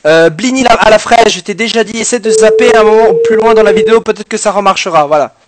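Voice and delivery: a hint of a creaky old man. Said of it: "je fais une voix de vieux". (légère voix de vieillard)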